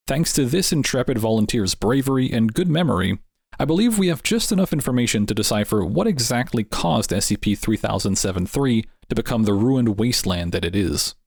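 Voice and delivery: plain reading voice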